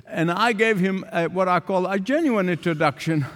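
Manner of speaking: normal talking voice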